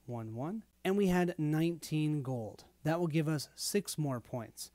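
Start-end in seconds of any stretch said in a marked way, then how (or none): none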